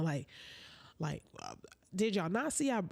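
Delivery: ordinary talk, nothing marked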